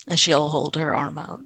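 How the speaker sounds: ordinary talk, nothing marked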